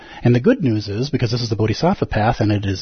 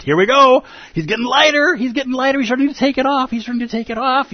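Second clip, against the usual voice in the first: excited storyteller; 13 semitones higher than usual. Acted. Teacher cheering the dragon story.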